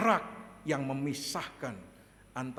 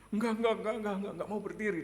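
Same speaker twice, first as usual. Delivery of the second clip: high, quick mock refusal, loud at first; imitating frightened child; 6 semitones higher, 3 dB louder, much faster than usual. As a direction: play a child scared to stand. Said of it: "Dia takut saya marah". (menirukan anak takut, menolak cepat)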